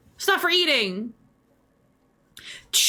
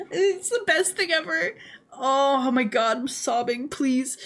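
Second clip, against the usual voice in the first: normal talking voice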